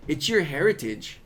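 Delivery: normal talking voice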